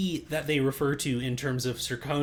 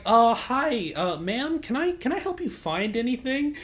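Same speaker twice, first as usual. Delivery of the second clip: nervous cashier; 8 semitones higher than usual. Retold or imitated. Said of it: "You're just like".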